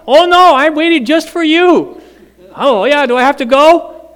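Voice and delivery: high, bright-eyed dog; dog insisting to owner, high and loud; eager dog voice. Acted. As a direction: play the dog fibbing sweetly. Voicing dog Cookie.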